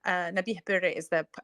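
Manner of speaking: ordinary talk, nothing marked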